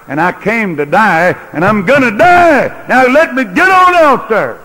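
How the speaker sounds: from firm to high, loud ringing cry; preaching at full force, building. Acted. Preacher voicing Jesus to the crowd, building to a shout. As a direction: build each line until it rings out.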